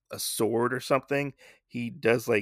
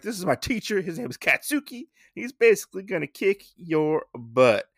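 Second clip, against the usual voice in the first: lightly voiced boastful kid, higher pitch; little Usagi; 8 semitones higher than usual. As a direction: pipe up like a kid bragging about a teacher. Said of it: "old little Usagi, he, uh, he's like".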